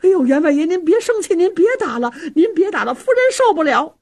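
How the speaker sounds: high, urgent maidservant's cries; calling out pleas. A narrator's reading. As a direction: plead with the master to stop beating. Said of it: "只能在旁边喊呢". (在旁边高声喊着劝阻)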